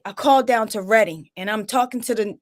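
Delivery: ordinary delivery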